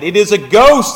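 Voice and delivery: frightened disciples' cry, read aloud; fearful cry. A narrator's reading. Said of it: "and they cried out".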